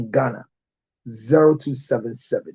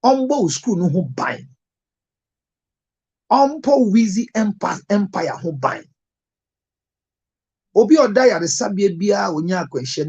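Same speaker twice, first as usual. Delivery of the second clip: raised, fervent blessing prayer; 4 semitones higher than usual. Acted. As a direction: pray protection over the listener with conviction.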